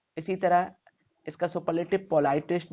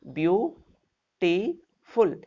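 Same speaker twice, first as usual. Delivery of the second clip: slow syllable count; 2 semitones higher, much slower than usual. Shown as a demonstration. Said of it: "एक, दो, तीन".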